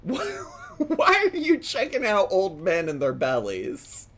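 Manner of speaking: slow, incredulous question through laughter; laughing disbelief. Real, not acted. Asking Tim about watching strangers, laughing in disbelief. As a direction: crack up while asking it.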